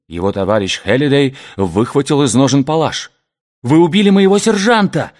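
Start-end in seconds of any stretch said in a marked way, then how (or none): 3.6-5.1 high, loud outraged cry; angry exclamation. A narrator's reading. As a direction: cry out in accusation. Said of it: "воскликнул он".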